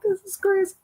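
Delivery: high-pitched imitated weepy whine; mock crying. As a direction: squeak it out as if in tears. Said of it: "I will cry".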